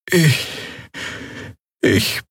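langsam, hoch, stockend alte Stimme; mühsam hervorgebracht. A narrator's reading. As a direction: Worte mühsam herauspressen. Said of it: "brachte sie hervor". (mühsam, alte Heilerin)

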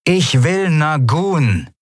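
slow, crackly speaker announcement; voice over a loudspeaker. Acted. Captain over the loudspeaker, crackling command. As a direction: boss speaking through the intercom. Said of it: "rauschte eine vertraute Stimme aus einem Lautsprecher".

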